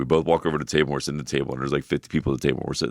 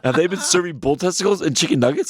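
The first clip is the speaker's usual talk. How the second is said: mock shock; 14 semitones higher than usual.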